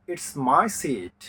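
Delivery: It's my seat spoken very slowly and clearly; very slow demo. Shown as a demonstration. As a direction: lay out each word slowly for learners. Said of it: "এখানে খেয়াল করুন". (খুব ধীরে স্পষ্ট করে বলা)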